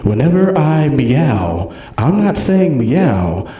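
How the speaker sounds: slow, deliberate, lowish cat voice; slow, ominous cat confession; talking cat. Acted. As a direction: spell it out slowly as a knowing cat. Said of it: "and the cat goes".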